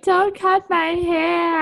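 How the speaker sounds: high, drawn-out mock wail; wailing model contestants. Imitating models dreading hair transformations, high mock wail. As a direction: draw it out like a distraught makeover contestant. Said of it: "they'd all be like".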